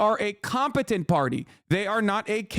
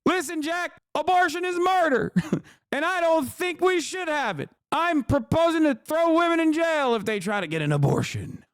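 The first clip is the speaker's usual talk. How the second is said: put-on much higher, cranky old-man voice; Biden impression; 9 semitones higher than usual. Streamer mocking Biden announcing an abortion ban, high and cranky. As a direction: bark it like a grumpy old president. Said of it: "coming out and being like".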